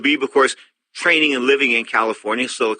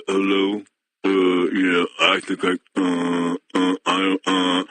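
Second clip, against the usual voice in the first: put-on lower, halting, lost-for-words punch-drunk voice; punch-drunk ex-fighter; 5 semitones lower, much slower than usual. Host imitating a punch-drunk fighter, halting and lower. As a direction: play a worn-out old boxer groping for words. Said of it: "I'll be talking like this one day".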